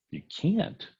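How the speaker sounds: high, incredulous echo of his words; disbelief. Retold or imitated. ER doctor echoing the surgeon, high and incredulous. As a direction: repeat it back as if you can't believe it.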